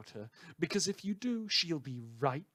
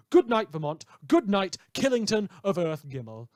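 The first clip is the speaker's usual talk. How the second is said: louder, grand farewell; radio sign-off; 5 semitones higher, 9 dB louder than usual. Acted. Radio host bidding his listeners good night, grand. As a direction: sign off the broadcast with a flourish.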